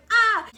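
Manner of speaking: very high, loud fan squeal; reenacted scream. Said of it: "And I was like".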